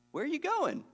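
high, incredulous Peter question; a mocking high-pitched Peter; mock clueless Peter. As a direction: play Peter as totally missing the point.

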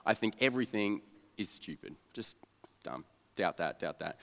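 low, muttered dismissiveness; jaded loner muttering doubts to himself; cynical. Retold or imitated. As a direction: grumble it quietly, unimpressed. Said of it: "I was just the cynical guy".

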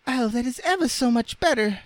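quick, very high squeaky cry; Raggedy Ann crying out. A narrator's reading. Rag doll after her head is pinned, crying out. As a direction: pipe it out in relief. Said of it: "cried Raggedy Ann".

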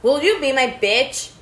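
loud high call to the device. Real, not acted. Calling the voice assistant.

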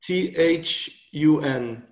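slow letter-by-letter, "T H U N" each letter separate and clear; spelling it out. Shown as a demonstration. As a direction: spell "T H U N" out slowly for a listener who missed it. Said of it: "can you repeat again?".

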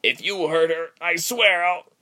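high, loud, heated warning; desperate threat. A narrator's reading. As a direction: threaten fiercely until cut off. Captive threatens villain, cut off.

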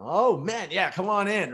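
high, loud, bubbling acted enthusiasm; imitated excited welcome. Doorman learns the guest is Canadian, imitated excited welcome. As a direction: play a doorman suddenly delighted to let you in.

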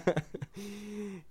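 soft, high little laugh; sheepish giggle. Real, not acted. Vlogger has just named an old sweetheart, sheepish giggle. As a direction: giggle quietly after naming someone.

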